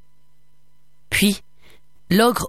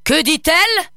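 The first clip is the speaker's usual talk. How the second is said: loud, much higher demand; gruff ogre voice raised; barking ogre; 11 semitones higher, 4 dB louder than usual. A narrator's reading. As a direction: snap the question at the table.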